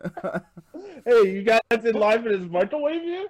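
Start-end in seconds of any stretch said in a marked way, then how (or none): 1.1-3.3 loud, high goofy stranger asking around; loud joking stranger. Pretend. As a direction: call out like a clueless guy in line. Acting a guy at the plant.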